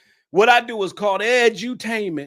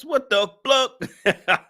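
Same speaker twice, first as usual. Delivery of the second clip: laughing disbelief; 7 semitones higher, much slower than usual. Real, not acted. Laughing at viewer.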